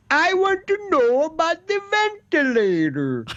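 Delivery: put-on high, almost-Italian accent falling away at the end; lilting mock Italian-Swedish politician accent; Andrew Cuomo accent. Governor briefing on ventilators, hammed-up almost-Italian accent. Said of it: "some kind of an almost Italian accent".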